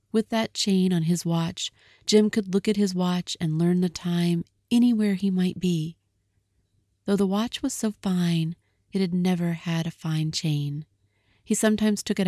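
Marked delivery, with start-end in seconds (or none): none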